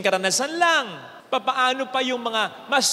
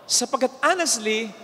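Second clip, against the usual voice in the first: ordinary delivery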